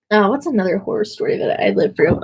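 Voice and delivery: ordinary delivery, nothing marked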